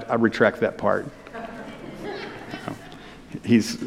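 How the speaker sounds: ordinary delivery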